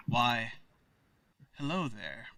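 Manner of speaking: low, soft suave greeting; low-pitched, hushed in-character hello; rejected character voice. Acted. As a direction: quietly try out a smooth, low greeter's voice. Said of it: "that's not the voice".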